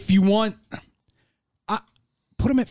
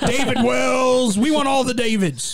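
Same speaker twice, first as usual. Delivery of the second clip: mock hype; 6 semitones higher, slower than usual. Hyped mock team owner.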